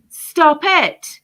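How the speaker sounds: loud, high, commanding stop it; forceful stop. Real, not acted. Vlogger telling parents to stop jabbing children, loud command. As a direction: cut them off hard, like an order.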